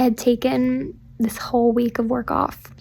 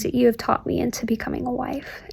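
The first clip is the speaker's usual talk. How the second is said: breath gives out into a whisper; choked up, words fading to a whisper; emotional whisper; 10 dB quieter than usual. Real, not acted. Tearful whisper to her sister on the wedding eve. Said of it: "making me emotional".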